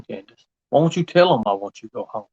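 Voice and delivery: ordinary talk, nothing marked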